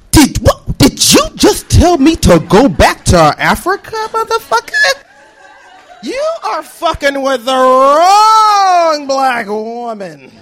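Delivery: slow, emphatic outrage with stretched words; indignant confrontation. Acted. Student turning on the man who insulted her, indignant slow challenge. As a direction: challenge him word by word, dragging out the key word. Acted confrontation after spinning round.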